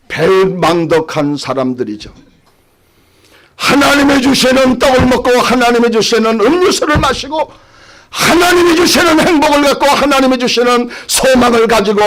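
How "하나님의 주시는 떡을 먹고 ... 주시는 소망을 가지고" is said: high-pitched, pressing proclamation; fervent preaching. Real, not acted. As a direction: proclaim it to the congregation in a raised pitch.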